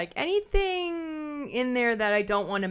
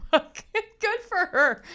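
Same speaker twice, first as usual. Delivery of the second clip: very high, squealed mock exasperation; mock frustrated outburst; 11 semitones higher, 4 dB louder than usual. Commentator voicing Justin's frustrated text, high-pitched mock outburst. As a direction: blurt it high, imitating someone at the end of their rope. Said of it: "he's frustrated. He's mad".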